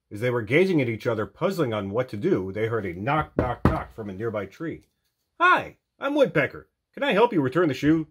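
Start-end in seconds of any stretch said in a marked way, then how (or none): 5.4-8.1 high, quick, eager helper; chirpy woodpecker. A narrator's reading. Woodpecker to the lost friends, high eager offer. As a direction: bounce in brightly to offer help. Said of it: "the bird asked".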